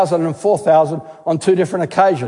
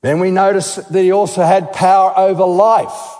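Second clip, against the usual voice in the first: ordinary talk, nothing marked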